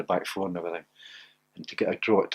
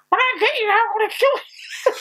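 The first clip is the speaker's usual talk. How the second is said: high yelled threat, laughing through it; mock furious threat; faster than usual. Chasing Chopper Harris round the pitch, high mock yell. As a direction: shriek the threat at him half laughing.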